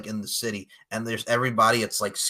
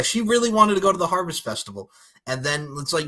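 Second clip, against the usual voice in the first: usual speaking voice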